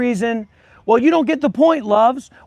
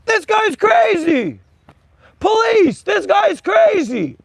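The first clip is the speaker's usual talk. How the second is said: mock panicked bystander; 10 semitones higher, slower than usual. Mimicking scared passers-by.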